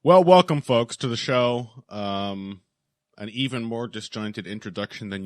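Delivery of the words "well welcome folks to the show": loud cheery welcome; podcast host intro. Acted. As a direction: open the show like a host. Restarting show intro.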